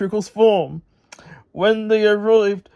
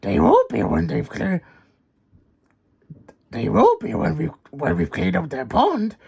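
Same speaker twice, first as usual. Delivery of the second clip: crying out. Acted. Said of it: "he cried".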